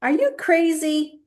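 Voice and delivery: playful fake indignation; mock outrage. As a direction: ham up being appalled at the idea. Sarcastic reply to history quip.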